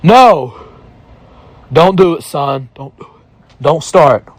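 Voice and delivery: a Southern voice warning him off; Southern accent. Retold or imitated. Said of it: "a more drawn out version of a Southern accent".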